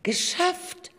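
high, bright triumphant cry; excited cheer. Acted. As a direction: cheer it out like you just won. Narrator voices joyful character.

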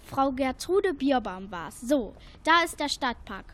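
usual speaking voice

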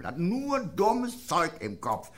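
slow emphatic. Retold or imitated. Repeating the barkeeper's remark.

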